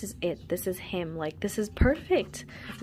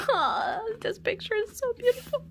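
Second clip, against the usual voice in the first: high, tearful-sounding fan squeal; overwhelmed gushing; 14 semitones higher than usual. Real, not acted. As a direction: gush in a high voice, as if about to cry.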